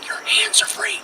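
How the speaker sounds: hushed, secretive whisper; soft whispered prompt; inner-voice whisper. Retold or imitated. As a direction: whisper it like a voice in the head. Voice in his head, first take.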